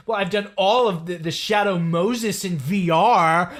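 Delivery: whiny Raiden impression. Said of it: "he says it just like that".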